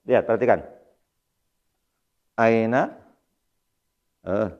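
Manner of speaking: normal talking voice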